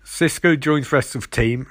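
ordinary talk

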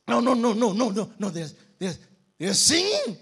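insistent prisoner arguing back; insistent denial. Acted. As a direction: correct him forcefully. Criminals argue back.

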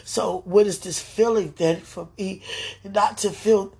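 ordinary talk, nothing marked

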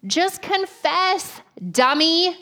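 slow, high, exasperated parent voice; high, slow mock scold at a fibbing kid. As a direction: drag it out like a fed-up mom. Parent scolding child over Cheetos.

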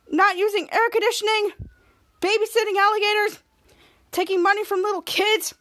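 put-on exasperated reproach; acted indignation. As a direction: reel off the misdeeds in a high voice. Kid Danger lists Ray's misdeeds.

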